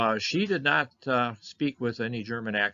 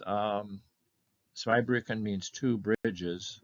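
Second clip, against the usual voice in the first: ordinary talk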